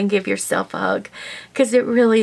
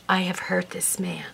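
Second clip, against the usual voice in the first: real tears, the words barely whispered; a real tearful whisper; 7 dB quieter than usual. Real, not acted. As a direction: whisper it, choking up with guilt. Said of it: "forgive me if I cry".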